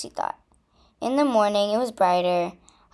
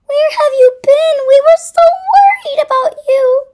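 high, loud relieved cry; worried mother crying out; 11 dB louder than usual. Acted. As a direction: call out in high alarm. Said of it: "Roxy's mom cried".

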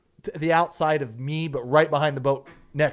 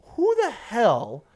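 slow and high, building to loud; indignant outburst; 11 semitones higher, 6 dB louder, slower than usual. Retold or imitated. Guide railing at the boater who left, indignant outburst. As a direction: demand it in loud, drawn-out disbelief.

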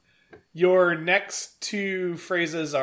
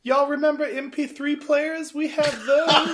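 higher chirpy mascot pitch; perky store mascot voice; bright squeaky sing-song welcome; 7 semitones higher than usual. Pretend.

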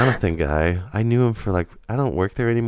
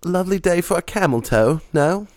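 bad British accent; 9 semitones higher than usual. Joking at the fake Englishman's wife, in a bad British accent. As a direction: ham up a terrible posh English voice. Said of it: "Mine sucks too".

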